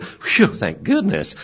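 slow, high relieved phew; relieved sigh. Retold or imitated. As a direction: let the tension out slowly. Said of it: "And she sort of".

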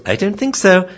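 high-pitched dog declining; dog's refusal. Acted. Dog answering screaming owner, high-pitched refusal. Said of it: "And the dog says".

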